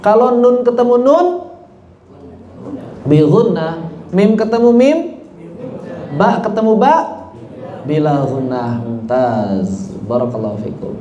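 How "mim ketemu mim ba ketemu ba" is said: normal talking voice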